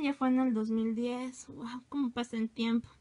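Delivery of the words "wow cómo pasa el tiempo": usual speaking voice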